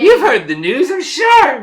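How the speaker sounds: high, loud squeaky voice; squeaky halfling. Acted. Halfling by the cart talks to travellers, squeaky and loud. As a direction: squeak it loudly in a tiny voice. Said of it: "this kinda squeaky voice like".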